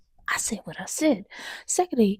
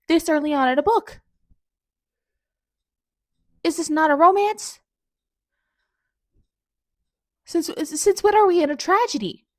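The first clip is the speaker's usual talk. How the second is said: higher and louder, indignant; indignant protest; 7 semitones higher, 7 dB louder than usual. Real, not acted. Reader protesting to the author about the tragedy, indignant. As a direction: protest loudly, betrayed.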